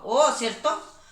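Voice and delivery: ordinary talk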